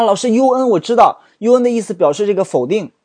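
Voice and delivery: a student piping up with the answer; eager student. Acted. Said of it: "你说啊".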